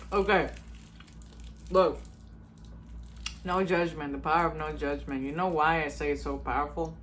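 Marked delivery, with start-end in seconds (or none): none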